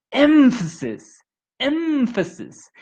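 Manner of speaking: "Emphasis" with the M and F held long and high; stretched consonants. Shown as a demonstration. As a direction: hold the M and F in "emphasis" like a teacher modelling. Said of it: "Make them".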